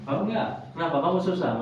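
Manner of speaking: ordinary delivery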